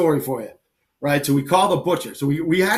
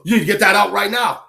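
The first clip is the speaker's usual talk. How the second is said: raised, higher mock-urgent command; alarmed butcher's order; 7 semitones higher, 4 dB louder than usual. Butcher ordering the hairy pig out of his shop, alarmed. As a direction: act out a butcher throwing someone out.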